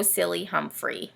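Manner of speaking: usual speaking voice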